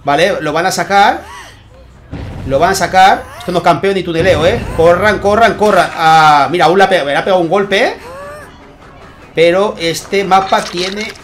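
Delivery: lively esports caster calling the action; energetic game commentary; live play-by-play. Acted. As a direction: call the match as it unfolds for the viewers. Live casting of tournament match.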